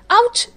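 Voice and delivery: sharp high cry of pain; sudden high ouch; pained yelp. Acted. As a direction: yelp as your foot is stomped. Meera stamps on his foot.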